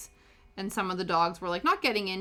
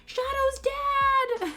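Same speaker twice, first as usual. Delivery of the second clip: put-on high, loud, drawn-out child's shout; little kid yelling for a dog's owner, high and loud; 6 dB louder, much slower than usual. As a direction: belt it out like a four-year-old calling across the room. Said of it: "just this child yelling".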